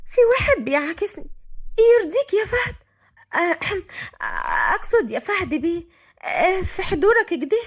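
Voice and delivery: high-pitched, simpering flirtation; coquettish. A narrator's reading. Enayat complains to Fahd, coquettish. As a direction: coo a flirty, faltering complaint. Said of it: "قالت عنايات بدلع".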